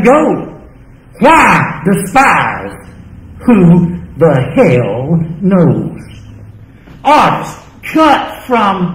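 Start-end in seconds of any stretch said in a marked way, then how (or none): none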